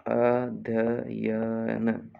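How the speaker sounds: "अध्ययन" very slow, each syllable drawn out. Shown as a demonstration. Teacher dictating a spelling, अध्ययन syllable by syllable. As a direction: stretch अ, ध्य, य, न in "अध्ययन". Dictation, word said then split.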